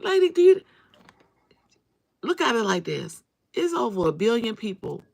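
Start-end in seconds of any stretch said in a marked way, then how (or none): 0.0-3.2 high, loud exasperated cry; exasperated outburst. Retold or imitated. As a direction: throw it at her in disbelief. Confronting the harassing lady.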